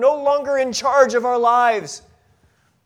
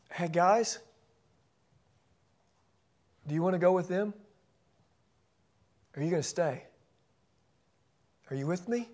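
softer, tender pleading questions; gentle questioning; 2 semitones lower, 8 dB quieter than usual. Retold or imitated. Jesus gently asks the twelve if they'll stay, soft and tender. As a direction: ask it softly, as a friend who might be left. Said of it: "I can almost hear ... tones in His voice".

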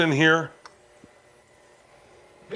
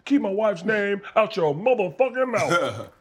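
[name] impression; 7 semitones higher than usual. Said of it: "He was like".

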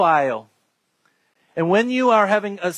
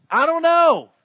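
high-pitched admission of not knowing; acted cheerful helplessness; candid shrug; 9 semitones higher than usual. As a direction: throw it out high, conceding freely. Repeats modelled answer to challenger.

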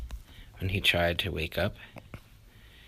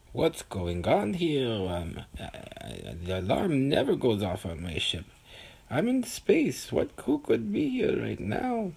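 startled spaceman; 7 semitones higher, 3 dB louder than usual. Acted. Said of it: "He said".

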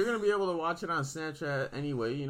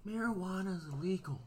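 lightly mimicked guard's scolding, pitched up and softer; lecturing security guard; 4 semitones higher, 5 dB quieter than usual. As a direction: imitate a guard reciting the rules. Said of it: "gives me the talk about".

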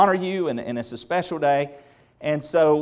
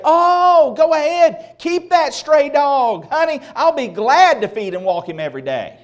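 much higher, louder, sing-song sweet mother; sugary mock mom; 10 semitones higher, 6 dB louder than usual. Preacher mimicking an impossibly agreeable mom for laughs, hammed-up sweet voice. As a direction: ham up an overly indulgent mom. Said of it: "Mom would never say".

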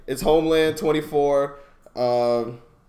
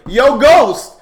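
mock street yell, higher and louder; imitated yell; 6 semitones higher, 9 dB louder than usual. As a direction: call out like yelling down the block. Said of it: "running out of cars and yelling".

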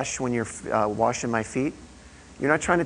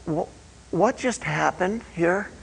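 higher, slow, stunned asking; an elder lost for words after a holy moment; dumbfounded question; 5 semitones higher, slower than usual. Retold or imitated. Elder asks the men by the campfire, slow and stunned. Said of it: "and he goes".

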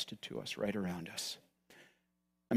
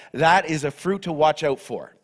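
usual speaking voice

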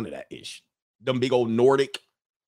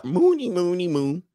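high singsong Muppet gibberish; slow, lilting mock-Swedish babble; Swedish Chef; 6 semitones higher than usual. Imitating what he expected Swedish to sound like, singsong Muppet babble. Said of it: "gonna be the Swedish chef".

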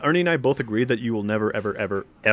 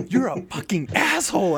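slower mock insult with a different voice; imitating angry friend; 9 semitones higher than usual. Mimicking Ernie swearing at him over the missed elevator, mock angry. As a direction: play the annoyed friend. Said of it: "and he's like".

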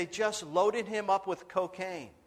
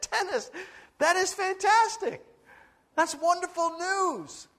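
much higher, bursting with delight; thrilled; 13 semitones higher, 5 dB louder than usual. Real, not acted. Pastor hears his friend's conversion, much higher and thrilled. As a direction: burst out with joy.

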